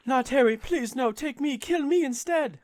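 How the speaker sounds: very high begging voice, acted out; desperate pleading. Reader voicing a mother offering herself instead, desperate high pleading. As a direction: beg frantically, stretching the final plea. Reads the mother's plea aloud.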